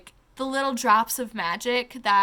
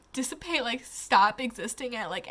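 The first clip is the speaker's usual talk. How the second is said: ordinary delivery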